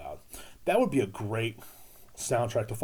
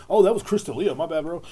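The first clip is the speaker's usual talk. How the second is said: higher, louder oops of realization; mock sudden realization; 7 semitones higher, 9 dB louder than usual. Comic correcting himself on a mixed-up name, mock surprise.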